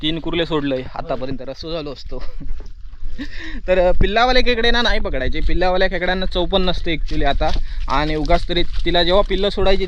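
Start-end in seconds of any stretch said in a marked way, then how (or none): 2.3-3.6 high-pitched laugh; short, high giggle. Real, not acted. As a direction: burst into a real laugh. Laughs after own remark.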